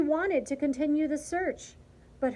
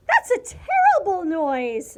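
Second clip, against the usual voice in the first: high, loud indignant snap; snappish; 7 semitones higher, 10 dB louder than usual. A narrator's reading. Martina rejecting the dog's bark, snapping high and loud. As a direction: snap it in sharp disgust. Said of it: "snapped Martina".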